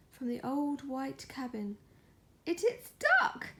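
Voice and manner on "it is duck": high, loud delighted shriek. Acted. Cat and squirrel shrieking on finding Duck. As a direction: burst in shouting with joy. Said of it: "they shrieked".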